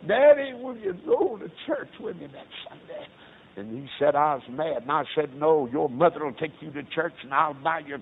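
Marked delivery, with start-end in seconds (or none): 0.1-3.1 put-on a higher, louder child's plea; little boy pleading with his father. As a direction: beg like a little boy asking his daddy. Little son begging his father.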